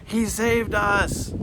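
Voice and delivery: very slow, high booming shout; bellow. A narrator's reading. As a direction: roll each word out huge. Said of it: "the ant bellowed".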